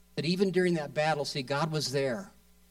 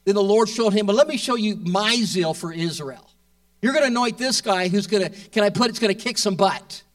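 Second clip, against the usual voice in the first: louder, driving sermon delivery; preaching hard; 3 semitones higher, 6 dB louder than usual. Real, not acted. Preacher rallying the congregation, loud and fervent. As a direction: push it out with building fervour.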